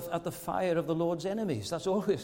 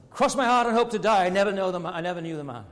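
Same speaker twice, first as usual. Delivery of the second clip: emphatic denial; 3 semitones higher, 7 dB louder, faster than usual. Retold or imitated. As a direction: swear innocence loudly, as Peter cornered. Acting Peter's louder second denial.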